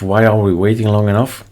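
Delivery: ordinary delivery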